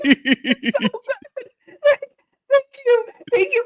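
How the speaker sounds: gleeful, giggling praise; laughing delight. Real, not acted. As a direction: savor it while laughing. Laughing over the weekend's wins.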